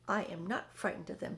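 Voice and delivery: plain reading voice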